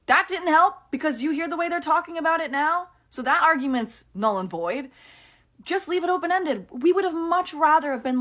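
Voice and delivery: a fan arguing with the show's defenders; frustrated. Real, not acted. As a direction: argue it heatedly.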